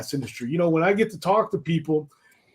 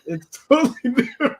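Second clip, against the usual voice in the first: laughing repeat; 8 semitones higher, faster than usual. Acted. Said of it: "It's, it's totally different".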